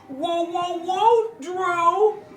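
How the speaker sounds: high excited whoa; excited calling out. Real, not acted. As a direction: call his name with delight. Calling out to guest.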